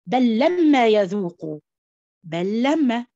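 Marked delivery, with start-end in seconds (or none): 0.1-1.6 "بل لما" merged, slow and loud; loud idgham demo. Shown as a demonstration. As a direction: boom out "بل لما" as one doubled لام. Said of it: "ادينا مثال تاني". (تلاوة عالية بطيئة بإدغام "بل لما")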